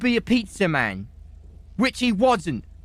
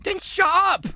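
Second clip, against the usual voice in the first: high-pitched exasperated yell; angry shout; 14 semitones higher than usual. Acted. Witness warned about sworn testimony yells back, angry high shout. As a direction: shout them down, voice leaping up.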